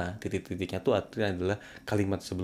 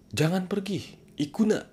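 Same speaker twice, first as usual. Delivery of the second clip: high, sharp don't-go command; high stern prohibition; 11 semitones higher than usual. Shown as a demonstration. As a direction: forbid it sharply, pitch high. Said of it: "sambil nadanya tinggi". (larangan tegas dengan nada tinggi)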